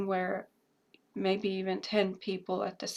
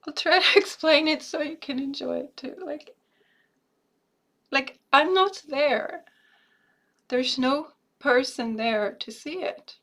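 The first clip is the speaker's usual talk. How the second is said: 1 emotional; 4 semitones higher, 6 dB louder than usual. Real, not acted. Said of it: "This is really emotional".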